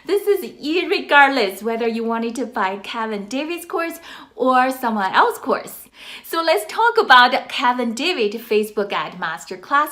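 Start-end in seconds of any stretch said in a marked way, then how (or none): none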